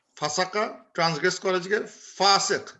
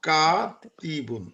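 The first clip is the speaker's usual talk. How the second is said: slow teaching model; much slower than usual. Shown as a demonstration. Teacher models the word slowly.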